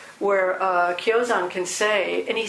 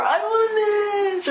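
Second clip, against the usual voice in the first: put-on much higher, slow, louder childish wail; mock tantrum cry; tantrum whine; 13 semitones higher, 4 dB louder, much slower than usual. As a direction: whine like a toddler on the floor. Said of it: "we throw ourselves down on the floor and we go".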